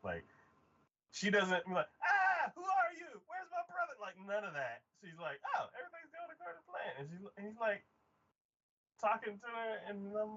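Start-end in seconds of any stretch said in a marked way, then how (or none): 2.0-3.9 high, hammed-up alarmed shriek; mock panic. Sister finding a stranger, mock panic. 5.5-7.0 high, soft, quick and breezy; mock nonchalance. Sister acting like nothing's wrong, mock nonchalance. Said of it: "acting like nothing's".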